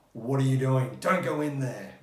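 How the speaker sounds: loud, urgent mock yell; shouting at screen. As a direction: yell like an exasperated film viewer. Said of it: "you want to shout at the screen".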